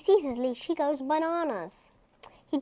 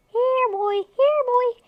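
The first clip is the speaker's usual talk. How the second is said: high, loud call across the room; 11 semitones higher, 9 dB louder, slower than usual. Acted. Boy calling his hiding puppy, loud and high. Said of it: "call him out slowly".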